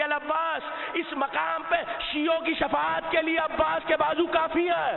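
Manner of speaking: full-force majlis oratory; preaching at full force. Acted. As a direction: proclaim it to the whole gathering. Fervent majlis oration.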